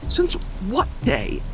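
high, scoffing disbelief; high incredulous young man. Acted. Derek scoffing at Josiah, high rattled disbelief. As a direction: laugh off the absurd claim, rattled.

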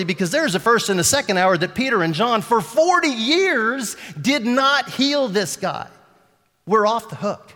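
forceful sermon delivery; preaching at full force. Acted. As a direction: build to a strong insistence.

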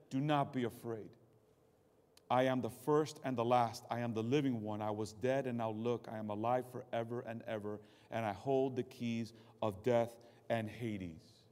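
low, soft and solemn; calm voice of Jesus. Acted. Jesus speaking to fallen John.

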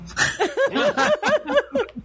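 a high burst of laughter. Real, not acted. Laughs at losing track.

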